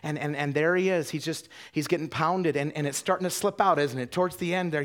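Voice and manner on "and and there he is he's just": ordinary talk, nothing marked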